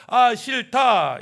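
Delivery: loud, drawn-out acted exasperation; fed-up groan. People tired of being told to obey God, fed up. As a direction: stretch it out slowly in loud weariness. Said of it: "이렇게 표현하는 거죠".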